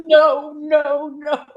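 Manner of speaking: high, loud mock horror; hammed-up embarrassed pleading; mortified protest. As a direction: wail it in comic dread of being recognized. Mortified patient's reply, retold.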